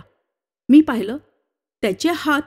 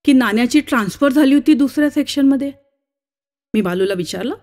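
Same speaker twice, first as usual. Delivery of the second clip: plain reading voice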